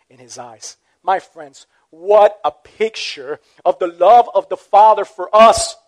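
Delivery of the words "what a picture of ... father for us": ordinary delivery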